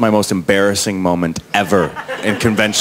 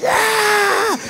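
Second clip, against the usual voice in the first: very high mock roar; drawn-out squealed crowd yell; imitated crowd cheer. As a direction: cheer like a whole audience.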